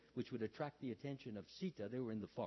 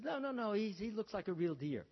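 higher, louder mock insistence; insistent protest; 8 semitones higher, 4 dB louder than usual. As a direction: lightly act out an eager objection. Sita argues with Ram.

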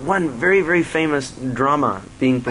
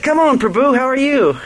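much higher, louder cheerful hail; delighted greeting; 12 semitones higher, 5 dB louder than usual. Retold or imitated. Director greets the monk, beaming and loud. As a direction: greet him like an old friend.

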